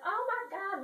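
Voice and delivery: high mock wail; mock crying. Girl crying over what happened, high mock wailing. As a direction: wail it in hammed-up despair. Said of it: "she's crying because".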